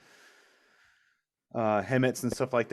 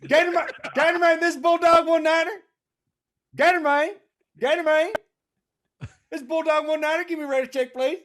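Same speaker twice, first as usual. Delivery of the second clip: high, loud country radio call; trucker radio call; 7 dB louder than usual. Retold or imitated. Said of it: "this is what we heard".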